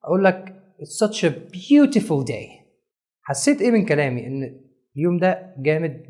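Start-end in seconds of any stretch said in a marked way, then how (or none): 0.8-2.5 "beautiful" slowly stressed in the sentence; slow down and lean on "beautiful"; emphasis on beautiful. Shown as a demonstration. Teacher showing emphasis, "beautiful" stressed. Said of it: "عشان أنا أأكد لك معلومة معينة".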